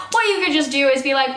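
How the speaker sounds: normal talking voice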